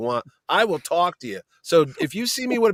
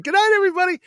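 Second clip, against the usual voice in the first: high, louder wife impression; sweet public farewell; 5 dB louder, much faster than usual. Wife dragging husband away from his guests, high-pitched farewell. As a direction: sing out a goodbye to the whole table.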